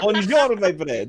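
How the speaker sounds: high, badly faked French accent turning Italian; laughing French-Italian muddle; French sliding into Italian. Shown as a demonstration. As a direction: attempt French and drift into Italian. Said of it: "it just turns into Italian".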